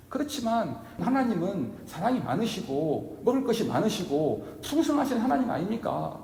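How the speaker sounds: a woman begging Jesus, rising to fervent entreaty; earnest pleading. Pretend. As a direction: beg with growing urgency. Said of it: "그 마음이 정말 간절했던 것입니다".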